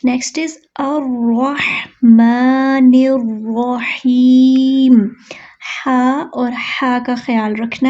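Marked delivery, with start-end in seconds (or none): 0.8-5.1 very slow melodic tajweed recitation; Quran recitation. Shown as a demonstration. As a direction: draw it out as tilawat. Recites next verse. (धीमी तिलावत)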